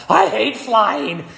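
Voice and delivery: high, slow, weary complaint; mock exasperation. Traveller stepping off a cramped flight, weary and exasperated. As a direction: drag it out like a fed-up traveller. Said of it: "and you're like".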